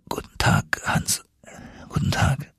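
injured Axel greeting Hans in a whisper; murmured. Acted. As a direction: breathe the words, too weak to speak. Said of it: "murmelte ich".

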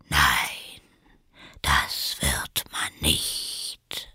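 low, soft whisper; caterpillar. A narrator's reading. The caterpillar coolly contradicts Alice in a whisper. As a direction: dismiss her in a low whisper.

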